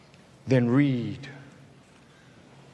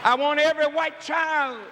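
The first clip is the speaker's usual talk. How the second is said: fiery preaching; 10 semitones higher than usual. Acted. Preacher to congregation about slavery, full-force shouting preach.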